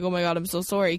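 low, quiet sorry; sheepish low apology. Retold or imitated. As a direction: mumble it, ashamed. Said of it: "he's like".

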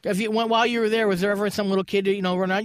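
ordinary talk, nothing marked

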